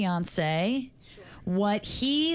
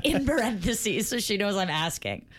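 ordinary talk

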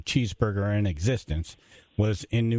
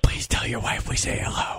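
breathy stage whisper; hushed but pushed; whisper yelling. Pretend. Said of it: "don't say it in a nice tone".

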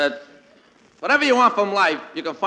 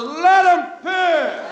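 higher, louder, slow insistent fan voice; arguing fan; 11 semitones higher, 4 dB louder, much slower than usual. Retold or imitated. One fan argues with another, insistent. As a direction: insist stubbornly from the bleachers. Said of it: "This fellow says".

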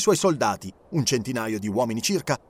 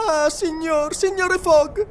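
put-on high, pleading young woman's cry; tearful young widow; 5 dB louder, slower than usual. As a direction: plead with him, close to tears. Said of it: "esclamò la giovane vedova". (giovane vedova che supplica con voce alta e commossa)

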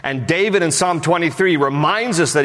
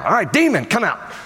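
hammed-up, high-pitched order to a demon; mock exorcism command; 8 semitones higher than usual. Disciple trying to drive out a demon, mock command. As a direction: play at casting out a demon.

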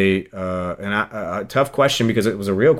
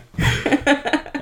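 breathy laugh. Real, not acted. Laughing at his own story.

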